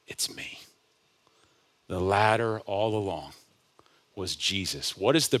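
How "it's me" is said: soft voiceless whisper, close and intimate. Acted. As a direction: breathe it like a secret to one man. Jesus whispers to Nathanael.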